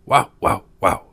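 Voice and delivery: quick string of woofs; barking dog. A narrator's reading. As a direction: bark the words like a dog. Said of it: "said the dog".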